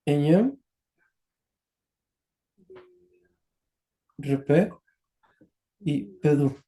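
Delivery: careful low pronunciation attempts; student repeating pedú; practising the words. Shown as a demonstration. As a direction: try the three words slowly. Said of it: "quería pronunciar".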